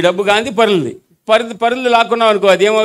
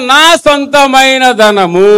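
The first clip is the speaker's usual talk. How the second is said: slow, louder, higher నా సొంతమైన ధనము; emphatic model line; 5 semitones higher, 6 dB louder, much slower than usual. Shown as a demonstration. Preacher modelling the line for the crowd to repeat, slow and loud. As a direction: stretch each word of నా సొంతమైన ధనము with force. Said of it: "ఇప్పుడు చెప్పండి".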